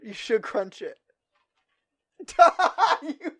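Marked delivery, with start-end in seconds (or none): 2.4-3.3 loud high laughter; high laughing fit. Real, not acted. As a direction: burst out laughing. Watching egg crunch.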